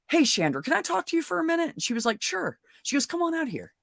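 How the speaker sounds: high, fast, falsely sweet invitation; mock sweet sister. The sister luring the girlfriend outside, high and sugary sweet. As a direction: sing it up high like nothing's wrong.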